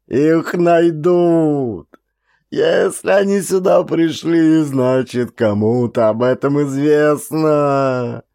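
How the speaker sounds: panicked. A narrator's reading. Frightened soldier warns the corporal, panicked. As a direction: blurt it out in terror.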